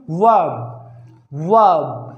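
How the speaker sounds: "वर्ब" as a long vuhb; drawn vowel, silent r. Shown as a demonstration. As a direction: stretch the vowel in "वर्ब" and skip the r. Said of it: "ए को हमें खींचना है".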